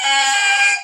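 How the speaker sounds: loud, held high-pitched "ehhh"; high alarm "eh". Retold or imitated. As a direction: sound the body's alarm, high and long. Body imitated as alarm going off.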